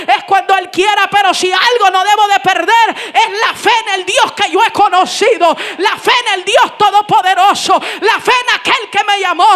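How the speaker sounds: very loud, driving sermon delivery; full-force preaching. Real, not acted. Preacher testifying that the family arrived, loud impassioned preaching.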